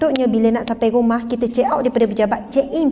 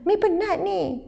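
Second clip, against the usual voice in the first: put-on higher, small boy pointing it out; child voice; 7 semitones higher than usual. Son Imran telling his mother she was fine earlier, childlike and higher. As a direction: play the little son, innocent.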